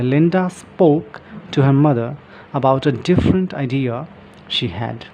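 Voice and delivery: slow, careful reading of the example sentence. Shown as a demonstration. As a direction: read it out word by word for learners. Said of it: "italics में जो words हैं". (उदाहरण वाक्य धीरे-धीरे पढ़ना)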